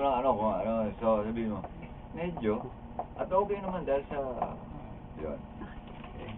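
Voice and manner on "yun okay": ordinary talk, nothing marked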